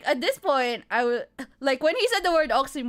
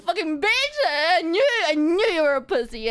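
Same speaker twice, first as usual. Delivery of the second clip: retold shout; 6 semitones higher, 4 dB louder than usual. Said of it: "Me shouting in the background".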